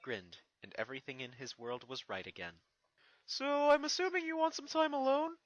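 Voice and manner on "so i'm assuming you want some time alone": can't believe his eyes; little dragon stunned to see his friend crowned; amazed young dragon. Acted. Spike asks.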